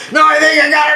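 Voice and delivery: high, loud insistent yell; retold yelling back. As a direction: yell back over the screaming patient. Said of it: "He's yelling back at me".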